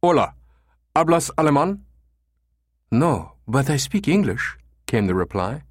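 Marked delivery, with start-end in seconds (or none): 0.0-1.8 put-on high, loudish Spanish with thick German accent. A narrator's reading. Caller posing as German tourist, thick German accent. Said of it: "with a thick German accent". 2.9-4.6 slow, higher Spanish receptionist; reply slowly as a pleasant woman; pleasant female voice. A narrator's reading. Receptionist answering a foreign caller, pleasant female voice. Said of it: "a pleasant female voice answered".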